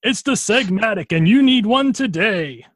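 high, loud, over-eager pitchman; infomercial announcer. Pretend. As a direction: push the sale hard. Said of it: "a late night infomercial".

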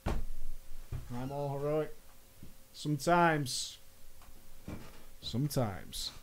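slow, hushed, low lament; hammed-up quiet sorrow; mock mourning. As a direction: draw it out softly in mock grief. Mock mourning over the lost guildmates.

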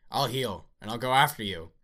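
loud, high, furious vow of revenge; furious threat. Acted. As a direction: shout the threat at full force.

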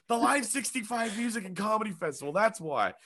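high, strained-up explanation; frantic justification. Retold or imitated. Comedian explaining why he came to town, frantic. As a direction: insist on it in mock desperation. Exasperated answer to hostile woman.

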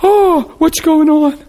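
high, eager oh what's going on; curious surprise. Retold or imitated. Guests running up to ask, a high surprised call. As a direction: call out as if rushing over. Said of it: "they came running".

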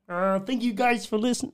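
high, failing Kermit attempt. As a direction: strain for Kermit. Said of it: "I can't do it properly".

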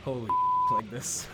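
slurred, high mock drunk voice; a giddy drunk Kylo; drunken stumble. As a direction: play it drunk and staggering.

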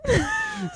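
high-pitched giggle. Real, not acted. Giggling fit over tongue twister.

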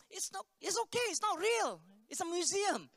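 retold dad's high-voiced reassurance; high-pitched coaxing. Father talks son into the museum cell, high-pitched coaxing.

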